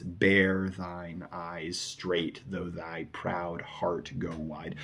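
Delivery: "Bear thine eyes straight" very slow, each word drawn out; long, dense line. Shown as a demonstration. Teacher showing the last line feels longer, slow weighty reading. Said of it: "feels longer and more dense".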